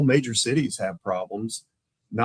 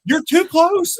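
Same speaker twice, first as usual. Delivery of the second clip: much higher and louder warning; exasperated insistence; 8 dB louder, slower than usual. Retold or imitated. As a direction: snap it back at him. Partner insists over phone.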